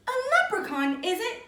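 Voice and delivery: put-on high, loud old lady exclaiming "A leprechaun"; old auntie crying out. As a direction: cry it out as a startled old aunt. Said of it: "cried Auntie Moira".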